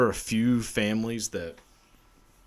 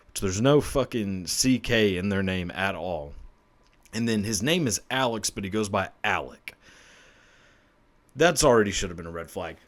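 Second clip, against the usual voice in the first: ordinary talk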